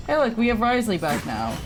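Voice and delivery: ordinary delivery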